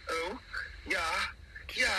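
high, hammed-up British moans; mock British woman. Host imitating an English lover, hammed-up British accent. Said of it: "scream my name with that accent".

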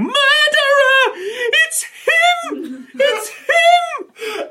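high, slow, loud shriek of murderer; hysterical screaming. Acted. GM voices the nurse accusing the player, screaming high. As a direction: shriek it like a terrified nurse pointing out the killer. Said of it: "she starts screaming".

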